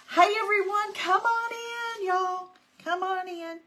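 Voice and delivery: high, loud welcome to arriving viewers; cheery welcome call. Real, not acted. Host welcoming viewers into the live stream, high and loud.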